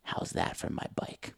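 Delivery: slow whisper; whispered taunt. Retold or imitated. Mom taunting the punched kid, slow whisper. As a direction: hiss the taunt softly.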